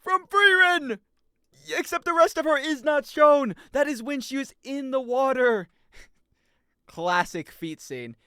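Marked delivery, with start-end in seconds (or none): 0.0-5.7 much higher, loud triumphant shouted announcement; excited host. Acted. Host declaring viewer Ashton correct, shouting. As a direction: crown the winner like a game show.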